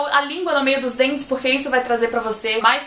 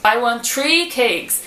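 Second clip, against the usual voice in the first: slow model sentence; much slower than usual. Shown as a demonstration. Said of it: "Ah, eu quero três bolos".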